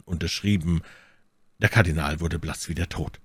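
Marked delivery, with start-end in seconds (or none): none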